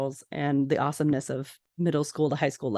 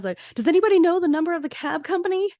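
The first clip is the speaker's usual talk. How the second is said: frantic mock panic; 12 semitones higher, 4 dB louder, faster than usual. Lorelai frantically asks for cab number.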